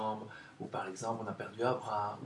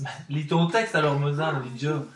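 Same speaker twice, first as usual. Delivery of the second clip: loud playful scolding; 3 semitones higher, 8 dB louder than usual. Real, not acted. Host teases pupil to read.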